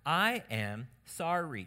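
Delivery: slow-motion teaching delivery; deliberate model apology. Shown as a demonstration. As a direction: spell the apology out slowly. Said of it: "Start saying these things".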